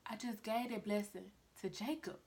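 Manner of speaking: the father owning up, barely audible; quiet confession. Acted. Guilty father admits.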